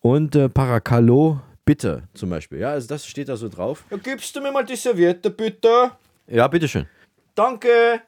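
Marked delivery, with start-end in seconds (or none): 3.9-8.0 high put-on voice. Acted request for napkin.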